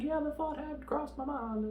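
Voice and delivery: very high-pitched comic comeback; hammed-up high voice of a hero left dangling; mock strained reply. Acting out dangling Bond's strained reply.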